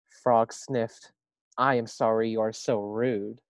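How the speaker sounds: lively storybook reading voice; reading to children. A narrator's reading. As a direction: read it aloud to a class. Reads story aloud to first graders.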